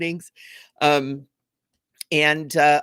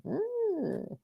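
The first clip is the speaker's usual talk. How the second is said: mock judgmental; 10 semitones higher, 3 dB quieter, much slower than usual. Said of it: "people are like".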